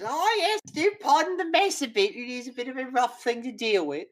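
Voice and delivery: put-on high, loud old lady voice; squeaky elderly woman beckoning. Bertha voiced by GM.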